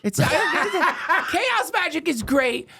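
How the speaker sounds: high-pitched, talking through laughter; laughing bafflement. Real, not acted. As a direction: laugh while protesting you don't get it.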